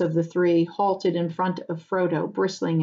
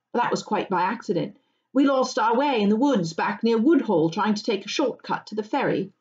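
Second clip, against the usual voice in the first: higher, lively polite voice; young hobbit, candidly explaining; 4 semitones higher than usual. Acted. Pippin admitting he crossed the fields, candid explanation. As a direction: explain yourself to the landowner.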